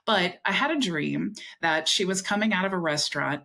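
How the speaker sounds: ordinary talk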